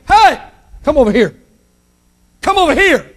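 high, insistent calls; calling for help. Retold or imitated. Peoples overseas to the church, calling for help. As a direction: hail someone from afar in short bursts.

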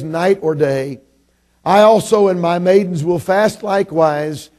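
ringing King James Bible reading; scripture reading. Real, not acted. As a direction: read the verse out to the whole congregation, measured and loud. Reads scripture aloud to congregation.

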